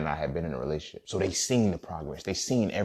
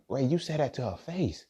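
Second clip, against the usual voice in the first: shocked friends; 9 semitones higher than usual. Retold or imitated. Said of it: "my homies looked like".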